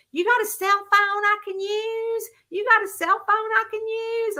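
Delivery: high, twangy hillbilly holler, repeated. Retold or imitated. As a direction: mimic a rough country stranger asking for a phone. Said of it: "it's some hillbilly".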